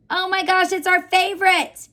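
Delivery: high, gushing excitement; excited. Acted. Teacher delighting the class over a favorite pattern, excited. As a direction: gush about it to the kids.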